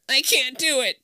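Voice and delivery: high whiny voice; whining. A narrator's reading. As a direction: whine like a scared kid. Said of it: "Todd whines".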